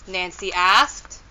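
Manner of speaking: usual speaking voice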